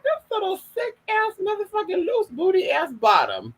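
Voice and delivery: high-pitched mock contempt; mocking put-down. Telling viewers about a rival, high mocking put-down. Mocking someone in a retold remark.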